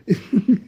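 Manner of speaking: high giggle; laugh. Real, not acted. Storyteller tickled by the pirate joke, giggling. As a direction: burst into a short high laugh.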